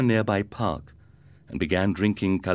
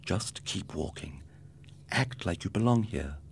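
much softer, slowish self-talk; inner thought; 7 dB quieter than usual. A narrator's reading. Ricardo tells himself to keep walking, soft inner voice. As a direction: murmur it to keep your nerve. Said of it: "he thought".

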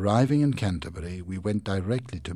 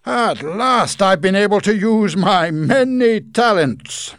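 high, loud, slow proud exclamation; exclaiming; 13 semitones higher, 15 dB louder, slower than usual. Acted. Micawber proud of his triumph, high and loud. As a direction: crow with delight. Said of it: "Mister Micawber exclaimed".

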